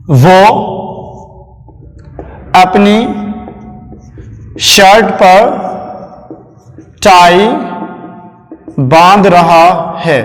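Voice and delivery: word by word, long pauses between. Shown as a demonstration. Dictating the sentence while writing. (रुक-रुक कर, धीरे-धीरे लिखवाते हुए)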